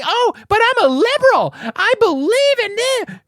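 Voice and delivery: high, whiny mock of a liberal pleading. Host mocking a liberal begging to be spared, high mock whine. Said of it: "you can't run outside and say".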